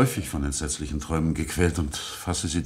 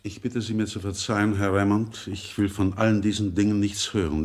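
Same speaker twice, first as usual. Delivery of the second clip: ordinary talk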